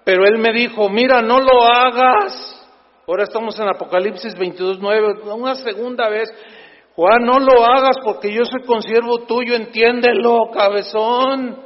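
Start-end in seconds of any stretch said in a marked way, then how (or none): 0.9-2.7 stern angel. Acted. Angel's second warning. 7.0-11.6 loud, exasperated scolding of John; exasperated angel. Pretend. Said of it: "Yo le hubiera puesto ahí".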